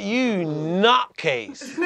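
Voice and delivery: fond mock insult through a laugh; drawn-out laughing nutcase. Looking at the priest in disbelief.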